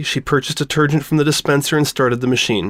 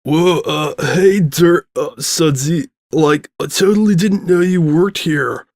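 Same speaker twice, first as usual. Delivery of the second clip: stoner drawl; 5 semitones higher, slower than usual. A narrator's reading. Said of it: "soft, masculine voice".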